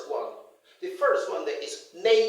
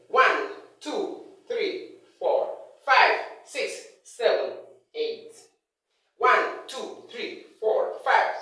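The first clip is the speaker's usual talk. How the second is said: strong beat on each number, like ONE, FIVE, NINE; rising and falling tone. Shown as a demonstration. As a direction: count from one to twenty in punchy rising and falling beats. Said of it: "rising and falling tone".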